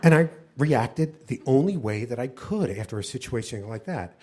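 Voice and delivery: ordinary delivery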